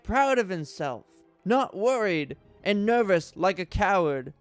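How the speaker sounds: normal talking voice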